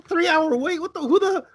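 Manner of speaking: high, incredulous sputter; retold disbelief. Friend scoffing at a three-hour line, high disbelief. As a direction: pitch it up in mock outrage at the wait. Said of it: "I'm like".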